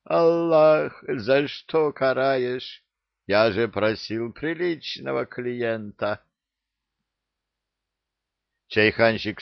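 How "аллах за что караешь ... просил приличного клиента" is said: despairing lament. A narrator's reading. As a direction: cry out to heaven in despair. Said lamenting to Allah.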